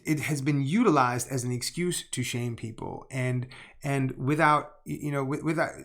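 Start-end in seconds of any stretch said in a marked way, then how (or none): 3.1-5.7 real stumbling false starts; worked up and tripping over the words; riled-up stutter. Real, not acted. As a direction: keep restarting as the words pile up. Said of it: "I know I'm stuttering on this".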